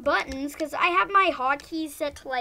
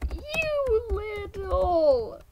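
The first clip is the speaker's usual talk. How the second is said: strained groan; 4 semitones higher, much slower than usual. Real, not acted. Frustrated at game.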